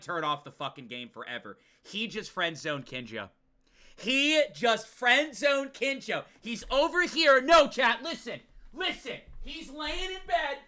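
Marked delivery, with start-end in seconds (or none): 4.0-6.2 high, drawn-out exclamation; outraged disbelief. Real, not acted. Streamer protesting to chat about a friend-zoning, high slow outrage. 6.4-10.6 high-pitched, incredulous explaining; worked-up rant. Real, not acted. Streamer setting up Yuki's bed scene for chat, high worked-up rant. Ranting to chat about Yuki.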